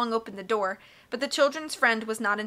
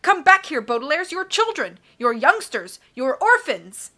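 raised, pleading call after them; ordering; 4 semitones higher, 6 dB louder than usual. A narrator's reading. Banker to children leaving across the sand, calling out urgently. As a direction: call them back, high and loud. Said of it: "he ordered".

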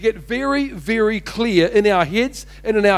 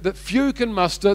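normal talking voice

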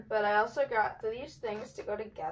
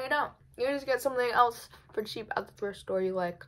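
usual speaking voice

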